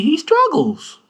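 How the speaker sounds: high squeaky teasing lilt; mock high teasing. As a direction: poke fun way up high. Teasing his literacy.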